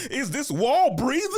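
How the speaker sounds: loud, slow, laughing mock wonder at the wall; mock puzzled. Cousin teasing the hidden kid, slow laughing mock wonder. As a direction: pretend to ask a wall if it breathes.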